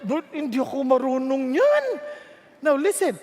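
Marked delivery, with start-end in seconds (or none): 0.1-1.8 higher whiny protest; mock-whining excuse to God. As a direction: play the reluctant servant. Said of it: "Pag sinabi ng Diyos".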